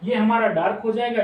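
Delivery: normal talking voice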